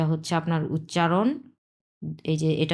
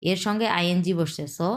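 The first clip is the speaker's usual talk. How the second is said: normal talking voice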